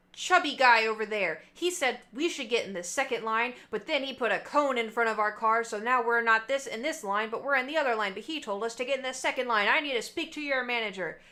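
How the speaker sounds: mock the Karen who wants the manager; loud retold customer tirade; entitled Karen demand.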